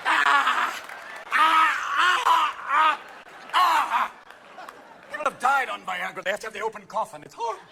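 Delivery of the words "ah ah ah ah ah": mock screams. Screaming grandma.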